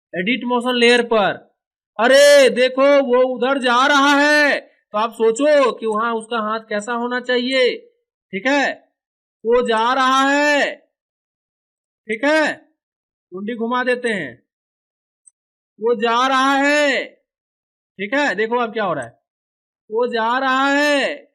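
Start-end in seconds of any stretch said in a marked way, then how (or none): 2.0-4.6 loud, high, slow call. Acted. Onlooker pointing out someone leaving, drawn-out loud calling. 9.4-10.8 slow, high acted call; drawn-out calling. Onlooker watching someone go, slow drawn-out calling. 15.8-17.0 slow, high acted call; drawn-out calling. Onlooker watching someone go, slow drawn-out calling. 19.9-21.2 slow, high acted call; drawn-out calling. Onlooker watching someone go, slow drawn-out calling.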